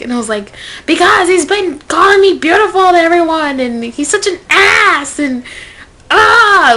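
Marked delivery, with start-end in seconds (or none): 0.8-5.2 loud, high-pitched exasperated rant; retold angry outburst. Complaining to her friend about the jerk, angry. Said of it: "like, just so angry". 6.1-6.7 loud, high "ah" of exasperation; frustrated yell. Retold or imitated. Fed up with him calling her beautiful, exasperated yell. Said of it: "like, just so angry".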